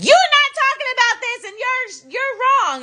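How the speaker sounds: high nasal-free whine mocking critics; squeaky mock of the naysayers; mocking high whiny voice. Mocking critics who call her wrong, high whiny voice.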